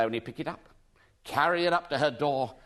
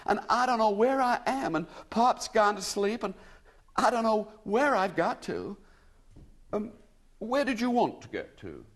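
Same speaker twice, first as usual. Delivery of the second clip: higher, lost American woman near tears; tearful; 7 semitones higher than usual. A narrator's reading. American lady telling William she is lost, tearful. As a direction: plead on the edge of a sob. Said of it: "she ended in a sob".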